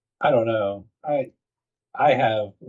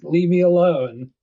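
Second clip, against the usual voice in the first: pitched-up, slow whine of refusal; whiny refusal; 8 semitones higher, slower than usual. Retold or imitated. Player turning down the company recruiters, high slow whine. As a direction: drag it out, begging to be left alone. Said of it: "You're like".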